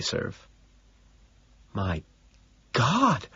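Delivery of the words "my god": soft gasp that leaps up in pitch; quiet, rising exclamation. A narrator's reading. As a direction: gasp it in hushed shock. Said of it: "Evelyn gasps".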